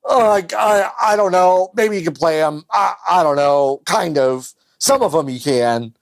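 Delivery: agonized, hesitant hemming and hawing; tortured. Acted. Newer console struggling to answer about old games, tortured. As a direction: start high and strained, then ease into reluctant half-answers. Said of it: "more tortured in the sense of just".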